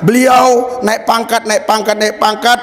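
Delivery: high, driving sermon delivery; impassioned preaching. Real, not acted. Preacher tells congregation of repeated meetings, high impassioned preaching.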